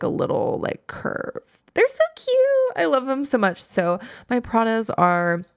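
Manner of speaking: ordinary talk, nothing marked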